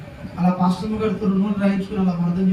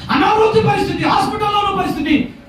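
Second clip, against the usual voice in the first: high, shouted lament; impassioned preaching; 11 semitones higher, 3 dB louder than usual. Real, not acted. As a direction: cry out each item to the crowd. Preacher listing pastor's sufferings. (ఆవేదనతో అరుస్తూ బోధ)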